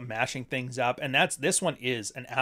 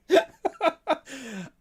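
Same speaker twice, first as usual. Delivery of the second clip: high laugh; 13 semitones higher than usual. Real, not acted. Streamer cracking up over the tweet, high laugh. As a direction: burst into real laughter.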